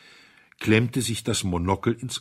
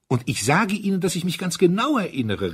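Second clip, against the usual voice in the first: much higher and louder, insistent; excited cry; 11 semitones higher, 6 dB louder than usual. A narrator's reading. Duke insisting to Mister Audley, excited cry. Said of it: "rief der Herzog aufgeregt".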